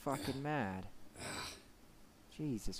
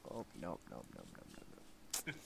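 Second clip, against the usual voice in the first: fading nopes; 7 semitones lower, 13 dB quieter than usual. Real, not acted. Backing away from cannibals.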